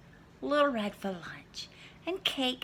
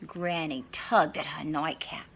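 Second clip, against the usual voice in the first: usual speaking voice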